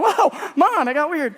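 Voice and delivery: jumps up high and loud, taken aback; mock-alarmed teen; startled whoa. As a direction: recoil at mom's odd demand. Mom says he can starve tonight.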